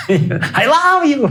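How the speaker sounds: high, slow mock love cry; hammed-up wail through laughter; imitated yelling. Mimicking the radio lady's declaration of love, high mock yell. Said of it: "started yelling on the top of her lungs".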